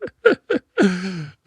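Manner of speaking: high laugh trailing off; high laughter fading. Real, not acted. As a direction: let the laugh die away. Still laughing at the joke.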